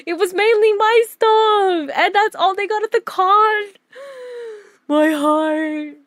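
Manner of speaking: high, drawn-out teary voice; overwhelmed, close to crying, voice climbing high; tearfully touched. Real, not acted. Artist moved by fan's haul post, tearfully touched.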